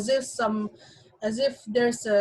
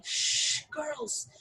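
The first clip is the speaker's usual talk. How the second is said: hushing warning; 6 semitones higher, 5 dB quieter than usual. Acted. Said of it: "she gave a loud warning".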